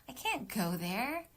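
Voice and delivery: slow, sighing and resigned; heavy-hearted read; weary sigh take. Shown as a demonstration. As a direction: let the sigh carry the line.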